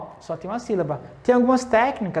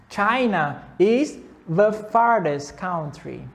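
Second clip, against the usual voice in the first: slow model sentence; slower than usual. Shown as a demonstration. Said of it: "teacher models the sentence".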